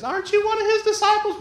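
put-on high-pitched little girl voice. Little girl questioning Peter about Jesus, high child voice. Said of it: "Little girl comes and says".

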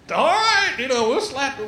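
high, breezy put-on voice; mock reluctant reply. Preacher mocks his reply to the grown man, high and breezy.

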